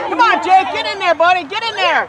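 yelling from the sidelines. Real, not acted. Cheering son from sidelines.

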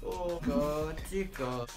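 normal talking voice

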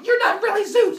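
high, loud acted outburst; furious high-pitched yell; angry accusation. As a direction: shout it in outraged disbelief. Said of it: "getting all grumpy and angry and unhappy".

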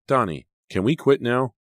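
mild calling-out to end a game; pleading call. A narrator's reading. Calling hidden child, wanting to quit.

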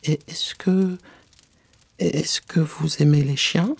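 high, slow, halting enquiry; hesitant question. Acted. Alice changes the subject with the mouse, hesitant and high. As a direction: grope for a safer subject. Alice gropes for a new topic.

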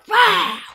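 loud, high roar; monster roar. Acted. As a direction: roar like a wild thing. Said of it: "roared their terrible roar".

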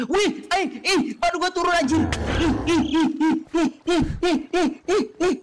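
high frantic squeals while fleeing; terrified squealing. Real, not acted. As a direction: squeal and pant in panic.

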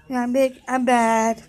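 ordinary talk, nothing marked

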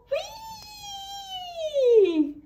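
very high gleeful squeal; whee. Acted. Passengers racing down the mountain, very high gleeful squeal. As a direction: squeal with joy on the way down.

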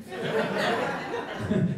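high, airy unvoiced giggle; high breathy laugh. Real, not acted. Own joke lands.

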